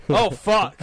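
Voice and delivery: loud realizing oh fuck; retold loud groan. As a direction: blurt it out as the penny drops. Stoned friend realizing he's high.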